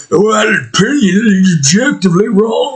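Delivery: high-pitched mocking critic voice; mock objector. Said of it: "you might be like".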